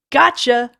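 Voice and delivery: high, loud pouncing cry; sudden triumphant yell; shriek. Acted. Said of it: "A mouth gaped and shrieked".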